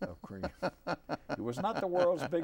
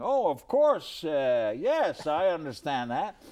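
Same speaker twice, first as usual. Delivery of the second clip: mock eager agreement; 4 semitones higher, 9 dB louder, slower than usual. Retold self, fighting sleep.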